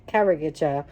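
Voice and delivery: usual speaking voice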